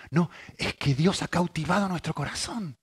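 usual speaking voice

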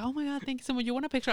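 high, soft sweet thanks; imitated grateful actress. Actress thanks a fan and offers a picture, sweetly.

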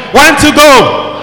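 slow, firm soldier count. Shown as a demonstration. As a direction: bark it out like a drill sergeant. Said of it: "You are going to read it like a soldier".

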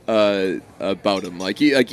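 usual speaking voice

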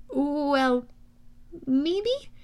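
drawn-out, hesitant, rising answer; tentative, ending high; sheepish. Acted. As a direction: venture a timid guess to an angry boss. Sheepish answer to the angry god.

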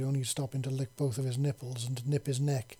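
ordinary delivery, nothing marked

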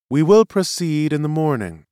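lightly voiced presiding official; seer presiding. Seer addresses the tribunal, presiding. Seer opens next session.

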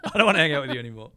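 jokingly ditch a mate, laughing; laughing mock sulk. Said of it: "I said to James".

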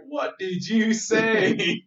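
high, loud, slow incredulous question; floored disbelief. Retold or imitated. Hearing it the first time, loudly astonished. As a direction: stretch it out in mock shock. Retells floored reaction to the word.

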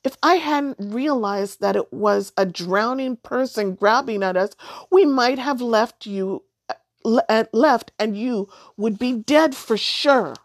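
put-on a hurt girl argues with the witch who scolded her; indignant outburst. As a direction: fire it back at her, stung. Girl answers the witch in anger.

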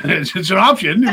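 high, loud, stammered through laughter; laughing excuse. Retold or imitated. Man defending Boo Boo's house, laughing excuse. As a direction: laugh while defending a bad idea. Retells his sheepish answer, laughing.

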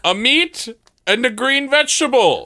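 high, quavering heroic knight; hammy stage actor. Acted. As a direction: declaim it like a stage hero. Knight announces himself.

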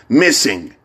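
usual speaking voice

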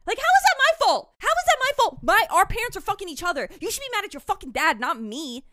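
high, loud outraged yelling; shrieking indignant accusation; furious rant. Acted. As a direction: tear into him like a wronged girl. Sidney ranting at Billy.

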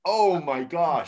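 drawn-out, cringing dismay; slow it down as you cringe. Real, not acted. Host appalled by the princesses remark, cringing. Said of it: "but I'm cringing".

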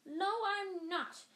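high and slow, protesting; mock-indignant squeak; high defensive denial. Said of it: "said Hermione".

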